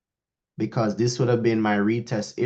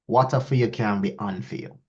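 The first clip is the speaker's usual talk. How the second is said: saying the proverb in Jamaican patois; a Jamaican mother's proverb, in patois; 3 semitones lower than usual. Shown as a demonstration. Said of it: "let me say it in patois".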